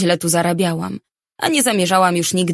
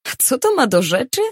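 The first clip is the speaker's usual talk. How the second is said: higher, annoyed snap of a question; angry huff; 6 semitones higher than usual. A narrator's reading. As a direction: huff it out, irritated. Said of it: "sapnęłam ze złością". (sapnięcie ze złością, wyżej)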